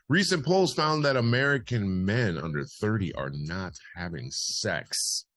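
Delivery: punchy bulletin, slowing on the last words; news read. Acted. Host reads a parody poll story, news read. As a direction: announce it like a serious poll story.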